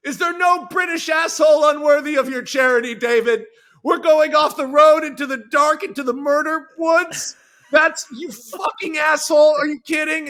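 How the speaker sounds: shouted high and loud, exasperated; angry shout. Retold or imitated. David yelling at his friend for stopping. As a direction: yell it at your friend in outrage. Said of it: "David goes".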